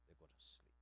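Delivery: breathy whisper, barely there. Shown as a demonstration. Preacher repeating the poet's line to the congregation, whispered. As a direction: hush it like lulling worries to sleep.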